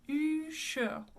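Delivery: rounded U, schwa ending on Usher; French pronunciation of Usher. Shown as a demonstration. Teacher repeats after explaining the schwa, French pronunciation. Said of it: "with a schwa".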